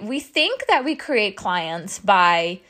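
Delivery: ordinary delivery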